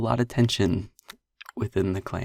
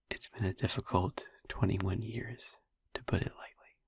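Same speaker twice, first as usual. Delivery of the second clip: soft whisper; 4 dB quieter than usual. Acted. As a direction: whisper it close, like a secret confession. Tender confession to the listener.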